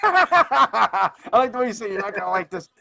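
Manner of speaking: talking through a laugh; amused, laughing delivery. Real, not acted. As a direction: laugh out the words. Laughing at his line.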